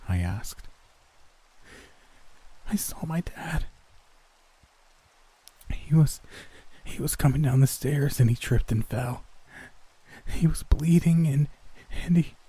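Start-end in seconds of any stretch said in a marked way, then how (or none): 2.7-12.3 high, halting, distressed voice; breathless. A narrator's reading. Shaken roommate recounting her father's fall, breathless. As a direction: break up the phrases with whispery gasps. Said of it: "cut herself off to take a few deep, rattling breaths".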